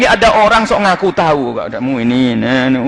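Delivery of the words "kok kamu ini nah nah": low, knowing murmur; mock seer voice. Know-it-all seer sizing up a visitor, lightly mocked. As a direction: play a smug seer, a touch lower.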